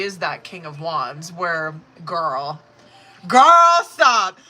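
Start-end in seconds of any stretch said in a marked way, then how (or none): 3.3-4.4 high, loud delighted cry; excited shout. Real, not acted. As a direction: shout it out, giddy. Excited reaction to the cards.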